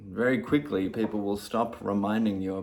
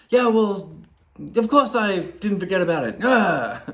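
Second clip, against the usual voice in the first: loud irritated snap, much higher; snapping; 9 semitones higher, 9 dB louder than usual. Retold or imitated. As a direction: bite back with a defensive snap. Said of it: "So I might snap at them".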